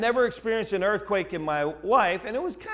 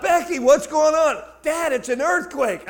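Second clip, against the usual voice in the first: calling out; 8 semitones higher, 6 dB louder than usual. Retold or imitated. Yelling to kids in street.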